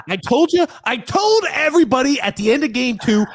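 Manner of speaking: high, loud told-you-so; gloating. Real, not acted. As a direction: crow about being right. Gloating about prediction.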